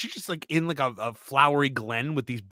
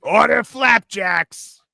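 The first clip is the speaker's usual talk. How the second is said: high, loud, slow order call; diner waitress shout; 9 semitones higher, 9 dB louder, much slower than usual. Retold or imitated. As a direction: bellow it across the diner. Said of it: "diner waitress voice".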